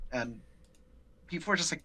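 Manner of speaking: ordinary delivery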